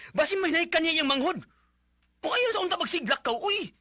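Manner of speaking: high, loud arguing; heated argument. Acted. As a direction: push back hard at her.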